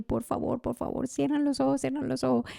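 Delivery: high, hushed, urgent begging; urgent hushed plea. Retold or imitated. Crouching and begging friends to close their eyes, urgently hushed.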